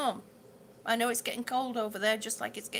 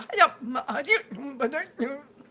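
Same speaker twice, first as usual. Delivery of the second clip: laughing; 5 dB louder than usual. Real, not acted. Laughing mid-story.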